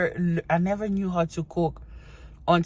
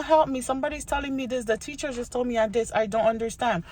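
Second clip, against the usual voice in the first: high, mock-distressed pleading; acted plea for help; 7 semitones higher than usual. Child calling mama for help, acted plea. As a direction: play a lost kid calling for help. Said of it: "I'll be calling my mama".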